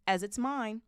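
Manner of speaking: high, loud indignant claim; furious. A narrator's reading. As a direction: press the point angrily. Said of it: "said Harry furiously".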